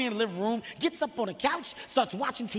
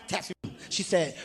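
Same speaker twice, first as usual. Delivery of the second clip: usual speaking voice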